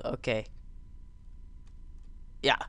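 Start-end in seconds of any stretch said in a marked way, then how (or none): none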